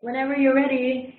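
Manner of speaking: loud call to the team. Real, not acted. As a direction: call out across the room. Host calling out to team.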